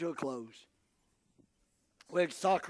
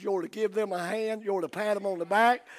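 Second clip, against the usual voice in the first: ordinary talk, nothing marked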